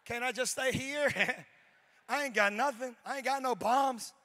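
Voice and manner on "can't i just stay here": mock scared whine. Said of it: "I was like".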